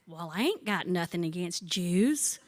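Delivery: put-on deadpan punchline in a Carter County accent. Hard-of-hearing bunco woman mishearing her friend, imitated Carter County accent. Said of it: "she talks like this".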